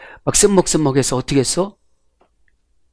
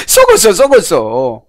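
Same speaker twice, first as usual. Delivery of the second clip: laughing; 3 semitones higher, slower than usual. Acted. Mocking rotten overwatered plant.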